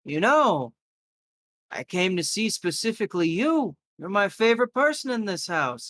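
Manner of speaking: lightly voiced affectionate visitor; gentle visitor voice. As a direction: speak kindly to the old man. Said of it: "puts his hand on his arm and goes".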